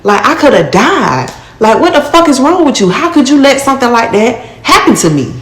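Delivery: retold outburst at the man who let it happen; retold angry outburst. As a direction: confront him again, voice up high and heated. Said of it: "So I'm stressing".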